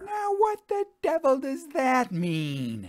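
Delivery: the widow bursts in demanding answers; demanding redhead. Acted. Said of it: "demanded the redhead".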